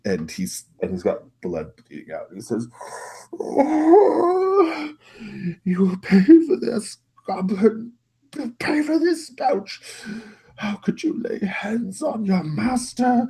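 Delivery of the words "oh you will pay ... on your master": very high, loud, acted wail; wailing master. Master with broken nose to goblin, wailing. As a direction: wail in pain and outrage at a servant. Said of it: "he's just, like, wailing".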